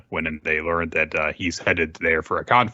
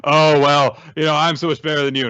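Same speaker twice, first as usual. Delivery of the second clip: higher, louder, fast smug boast; Narumi bragging; 7 semitones higher, 7 dB louder than usual. Retold or imitated. Narumi taunting his old academy rival, smug and loud. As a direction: brag like a cocky rival. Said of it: "'cause he's like".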